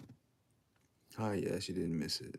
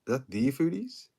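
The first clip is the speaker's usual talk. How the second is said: excited; 7 semitones higher, 9 dB louder than usual. Real, not acted. Spots a familiar viewer.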